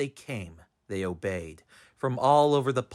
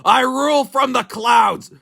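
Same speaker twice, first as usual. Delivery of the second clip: howling king; 12 semitones higher, 8 dB louder than usual. A narrator's reading. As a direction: howl down at the complainer. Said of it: "howled the mighty King Yertle".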